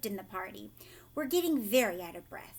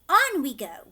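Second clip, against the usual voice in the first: brisk call; 4 semitones higher, 11 dB louder than usual. A narrator's reading. Wonka urging group on.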